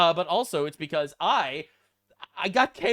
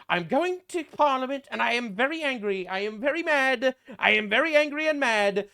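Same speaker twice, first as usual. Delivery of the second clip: mocking Keir Starmer; 5 semitones higher than usual. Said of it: "this is him being very angry and mad".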